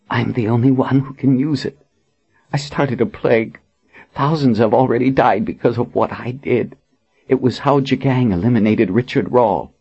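hoarse, haunted confession. A narrator's reading. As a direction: rasp out the guilt. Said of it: "in a hoarse voice".

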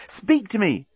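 high, urgent plea. A narrator's reading. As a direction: plead urgently, pitch lifted well up. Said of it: "A note of urgency had crept into Nettie's voice".

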